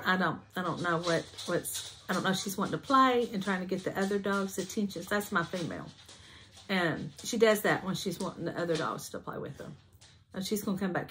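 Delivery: ordinary talk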